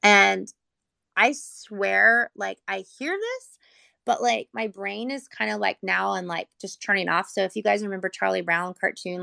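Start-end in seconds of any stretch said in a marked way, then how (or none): none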